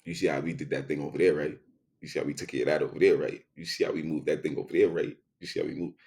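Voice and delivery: quick, sly boasting to a friend; fast knowing reply. Retold or imitated. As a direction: rattle it off fast, low and knowing. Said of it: "And I go".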